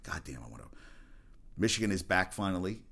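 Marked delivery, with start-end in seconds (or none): 0.0-0.7 muttered longing. Retold or imitated. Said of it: "I was like".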